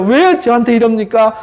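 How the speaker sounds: high-pitched, pleading protest; Job protesting. Acted. Job clinging to God and demanding answers, acted protest. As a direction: plead your innocence in rising indignation. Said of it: "하나님 향해서 막 항변해요".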